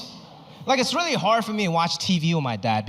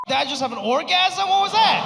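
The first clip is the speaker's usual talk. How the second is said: mock shock; 11 semitones higher than usual. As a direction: act stunned. Shocked reaction to sneeze.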